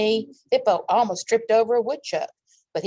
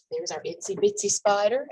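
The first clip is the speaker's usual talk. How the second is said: ordinary delivery, nothing marked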